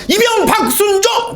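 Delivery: barked like a private reporting rank and name; military roll call shout. Retold or imitated. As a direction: snap it out like a new army recruit. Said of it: "그냥 관등성명처럼".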